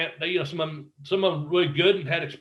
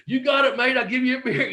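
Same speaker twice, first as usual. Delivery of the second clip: much higher, bright mock English mate; cheerful Englishman; 9 semitones higher, 3 dB louder than usual. [name] promising Bobby a beer, hammed-up English cheer. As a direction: toss it off like a friendly English wrestler.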